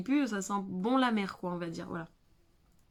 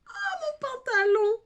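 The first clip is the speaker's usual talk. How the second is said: high, loud shocked yelp; real alarm at a stain; startled cry; 7 dB louder, slower than usual. Real, not acted. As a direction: cry out as powder hits the trousers. Powder spills on her trousers.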